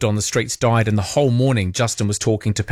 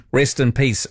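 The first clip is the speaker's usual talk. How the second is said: normal talking voice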